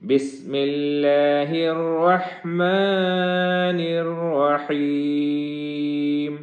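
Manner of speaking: slow melodic Quran chant; chanted Quranic recitation, long and melodic; tajweed recitation. Shown as a demonstration. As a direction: draw each word out in sung recitation.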